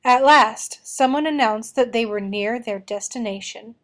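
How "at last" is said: ordinary delivery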